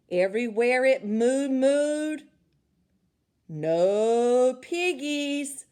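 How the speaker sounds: slow, loud mooing voice; lowing narration. A narrator's reading. Narrator mooing the cow's answer, slow and loud. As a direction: stretch the words out like a cow.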